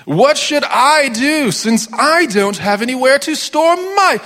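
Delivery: high snooty voice of a greedy man; greedy rich man. Acted. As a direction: ham up a pompous greedy hoarder. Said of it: "that's how greedy people talk".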